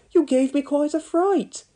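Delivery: high breathless fright; gasping fright. A narrator's reading. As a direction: still getting over the shock. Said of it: "gasped Elvis".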